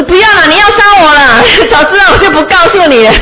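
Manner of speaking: high, giggling sheep voice that laughs through the words; laughing sheep. Acted. Sheep laughing at the scared Brahmin, high and giggly. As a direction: tease him with a laugh in a high voice. Sheep teases the frightened master.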